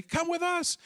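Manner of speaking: imitating excited women leading him; urgent summons. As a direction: sing it out as you pull him away. Two women grab his arms.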